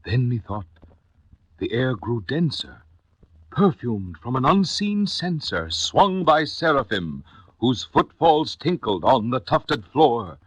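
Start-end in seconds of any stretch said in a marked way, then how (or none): none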